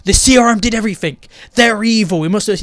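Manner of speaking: mocking Iris. Reviewer parodying Iris's sudden leap of logic, loud mock declaration. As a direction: blurt the conclusion too quickly, hammed-up. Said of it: "she's the one who's able to figure out".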